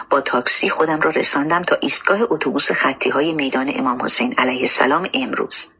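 usual speaking voice

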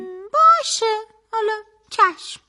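grudging agreement. Acted. Sibak giving in to the host's scolding, grudgingly. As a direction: give in with a stretched-out hum first.

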